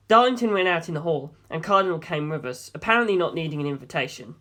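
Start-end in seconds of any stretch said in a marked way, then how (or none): none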